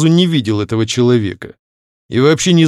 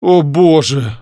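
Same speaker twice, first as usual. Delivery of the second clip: mildly shocked exclamation, pitched up; exclaiming; 4 semitones higher, 4 dB louder, slower than usual. A narrator's reading. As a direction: exclaim in dismay. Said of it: "воскликнул Андреевский". (восклицая)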